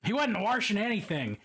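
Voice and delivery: Baltimore accent, warshing with an r; mock Baltimore accent, washing said warshing. Shown as a demonstration. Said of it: "That is not a particularly Balmer accent".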